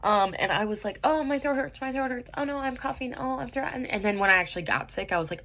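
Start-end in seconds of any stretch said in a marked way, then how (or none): 1.0-3.7 higher, fretful mock whine; lightly mocking her anxious past self; whiny worrying. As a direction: play up the hypochondriac panic.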